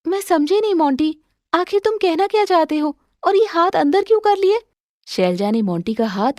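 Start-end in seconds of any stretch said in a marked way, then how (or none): 0.1-4.6 higher, puzzled wife voice. Acted. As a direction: demand to know, confused. Shailja confused. (उलझन में पति से पूछती पत्नी)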